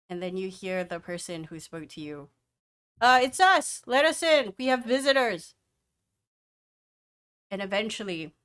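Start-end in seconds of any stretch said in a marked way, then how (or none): 3.0-5.5 calling out. Acted. Guard calling through heavy door.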